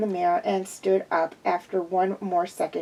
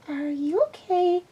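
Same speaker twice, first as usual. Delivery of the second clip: high, light filly voice; high childlike question; young pupil's voice; 8 semitones higher than usual. A narrator's reading. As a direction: ask it like a curious little girl. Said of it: "my gremlin of a pupil asked".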